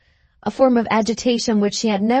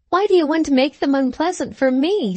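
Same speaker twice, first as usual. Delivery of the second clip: higher-pitched, helpless plea; helpless, near tears; 7 semitones higher than usual. A narrator's reading. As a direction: plead with a touch of rising tears. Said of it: "getting helpless again and feeling the hot tears rise".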